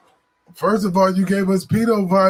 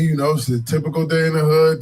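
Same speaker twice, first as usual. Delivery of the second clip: usual speaking voice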